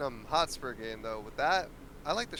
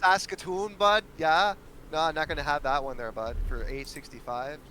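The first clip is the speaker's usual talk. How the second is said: put-on Canadian accent; 3 dB louder than usual. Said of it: "It's this Canadian accent for me".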